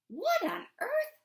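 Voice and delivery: high, loud and slow astonished exclamation; surprised cry. Acted. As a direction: gasp it out in wonder at the sky. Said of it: "Meg cried".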